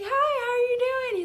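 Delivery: high, rushed, overeager hello; nervous greeting. Retold or imitated. Girl greeting her crush, nervously high and fast. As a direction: rush it out high, acting flustered.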